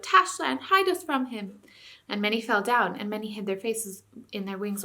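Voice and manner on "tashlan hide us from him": frightened cry. A narrator's reading. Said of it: "moaned and howled and called out".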